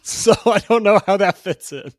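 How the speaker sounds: laughing. Real, not acted. Laughing at Pokeball art joke.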